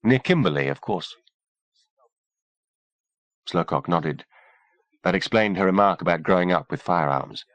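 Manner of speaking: a hint of a cool woman doctor. A narrator's reading. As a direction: lightly voice the poised doctor. Kimberly voiced, calm doctor.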